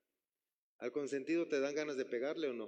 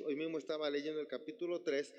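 ordinary talk, nothing marked

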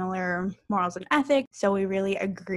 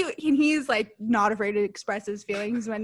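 ordinary delivery

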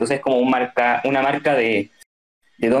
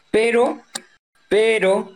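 "pero" repetido y alargado, buscando qué decir; pensativo, vacilante; 5 semitones higher, much slower than usual. Real, not acted. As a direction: atascarse pensando, con pausas entre cada "pero". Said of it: "Está pensando". (titubeo pensativo, primero agudo y luego bajando)

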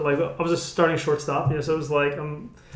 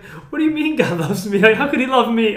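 higher and louder, disbelieving what?; stunned disbelief; 6 semitones higher, 5 dB louder than usual. Retold or imitated. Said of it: "I was like".

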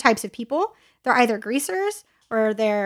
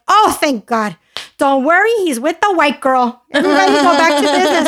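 put-on loud, higher reassuring old lady; a grandma loudly announcing all is fine; abuelita quote; 4 semitones higher, 6 dB louder than usual. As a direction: boom it out like a relieved grandma telling everyone to relax. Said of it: "the abuelita ... was like".

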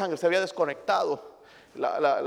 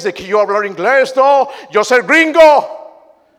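mock gringo; 6 semitones higher, 13 dB louder, slower than usual. Mocking those who act gringo.

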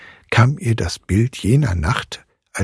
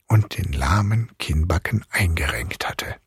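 ordinary delivery, nothing marked

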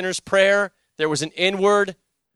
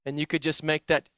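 normal talking voice

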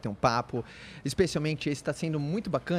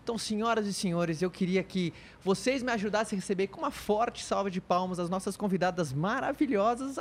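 usual speaking voice

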